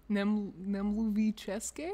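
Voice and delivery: slow, halting Czech in an American accent; butchered American accent. Shown as a demonstration. Checking her Czech attempt is close, butchered American accent. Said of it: "in butchered American accent".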